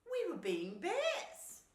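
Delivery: high, loud, drawn-out squirrel voice; squirrel's excuse. A narrator's reading. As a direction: explain it in a high little animal voice. Squirrel explains to the bear.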